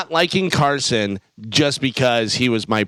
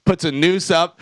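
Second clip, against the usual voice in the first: ordinary talk, nothing marked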